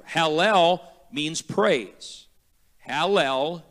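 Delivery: very slow, spelling out Hallel; slow teaching. Shown as a demonstration. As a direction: lay each word down slowly for the class. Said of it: "The first part of that is hallel".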